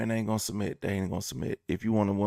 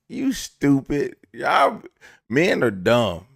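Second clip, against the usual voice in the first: exasperated scolding; 3 semitones higher, 9 dB louder, slower than usual. Real, not acted. Comedian telling men they are dumb, loud exasperated scolding. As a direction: shout down a foolish crowd.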